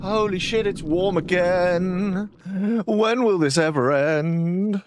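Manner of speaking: high, slow groan about the heat; exasperated heat complaint. Real, not acted. Streamer complaining to chat about the heat, high and drawn out. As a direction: moan at the heat like it's unbearable.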